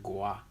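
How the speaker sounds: "Gua" low and soft, on its own; isolated, falling "Gua"; practising the ending. Shown as a demonstration. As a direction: quietly test the syllable "Gua". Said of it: "how you say the end".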